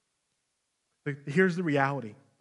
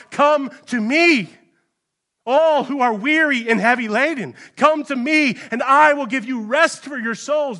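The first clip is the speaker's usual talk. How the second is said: crying out; 9 semitones higher, 10 dB louder than usual. Acted. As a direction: call out to the weary with all your voice. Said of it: "Christ is crying out".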